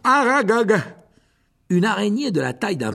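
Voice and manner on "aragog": high, even louder second call; monster spider shout. Acted. Giant spider calls its master a second time, shouting. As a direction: call the name again, pushing harder. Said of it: "cria le monstre".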